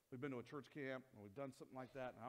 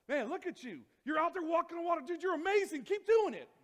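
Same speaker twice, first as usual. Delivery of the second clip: much higher, much louder excited cheer, building to the end; 18 dB louder than usual. Retold or imitated. Disciples cheering Peter walking on water, rising excited shout. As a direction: yell encouragement across the water, building.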